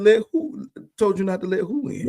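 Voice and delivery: shocked question, pitched well up; a pastor stunned by the guard's report, high and incredulous; surprised. Retold or imitated. Startled reply to security guard.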